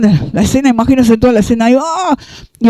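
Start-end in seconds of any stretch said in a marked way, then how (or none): 1.8-2.2 high short mock cry; mock shriek. Potiphar's wife staging a scream, short high mock shriek. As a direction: let out a staged high shriek. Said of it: "empezó a gritar".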